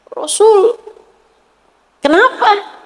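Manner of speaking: put-on high, fast, tearful girl's voice; crying girl. Slave girl fearing her master's anger, high and tearful. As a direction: blurt it out like a frightened little girl.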